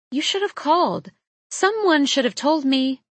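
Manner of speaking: high-pitched, flustered protest; upset reproach. Acted. Woman reproaching her surprise visitor, flustered protest. Upset at his unannounced arrival.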